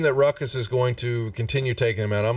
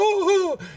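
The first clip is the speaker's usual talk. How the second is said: high-pitched whooping "oh-oh"; Varus whoop; 4 dB louder than usual. Acted. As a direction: let out a high, drawn-out whoop. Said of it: "our Varus will go".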